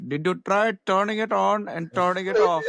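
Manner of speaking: mock Indian accent. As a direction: ham up an Indian help-desk voice with a laugh. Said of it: "some guy in India going to Kyle Busch".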